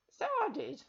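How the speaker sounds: put-on high, unbothered old woman; bland old witch. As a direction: shrug it off as the old crone. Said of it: "said the old woman blandly".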